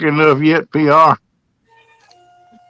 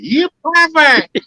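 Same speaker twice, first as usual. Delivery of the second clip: high mock woman; 11 semitones higher, slower than usual. A woman leaving, high and slow.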